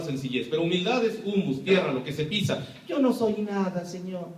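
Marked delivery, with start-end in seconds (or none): 2.9-4.3 a lightly voiced meek servant, head bowed; mock the submissive, humble plea. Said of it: "soy enemigo de la palabra humildad".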